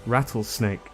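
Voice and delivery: ordinary talk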